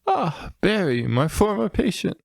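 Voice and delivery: high smug Doolittle; smug doctor. Retold or imitated. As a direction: sweet-talk an old enemy. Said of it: "then Doctor Doolittle goes".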